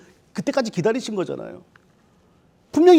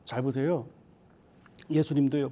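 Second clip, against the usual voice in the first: ordinary delivery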